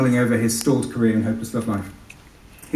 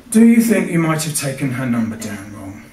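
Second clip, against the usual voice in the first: lightly put-on Australian accent; a touch of Aussie twang; 4 dB louder than usual. Policeman quizzing Tony, mild Australian accent. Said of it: "I can do an Australian one".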